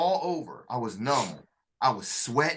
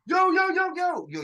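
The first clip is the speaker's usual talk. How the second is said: much higher, loud excited yo's; cheering crowd; 14 semitones higher, 6 dB louder than usual. Retold or imitated. Fellow recruits mobbing him after expert, cheering. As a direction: rush in hyped, celebrating. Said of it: "all y'all came up, came up".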